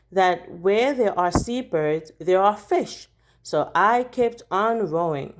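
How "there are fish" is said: ordinary delivery, nothing marked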